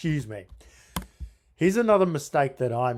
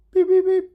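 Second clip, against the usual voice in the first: high, loud imitated dial beeps; phone beeps; 12 dB louder than usual. Caller mimics the phone dialling out, high, loud beeps. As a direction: mimic the phone beeping at full pitch.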